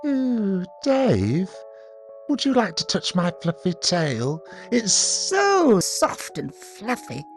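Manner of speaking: high creepy coaxing murmur, slow at the end; little whispery voice. A narrator's reading. Ian sitting close to David, creepy little whispery voice. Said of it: "in a sort of little whispery voice".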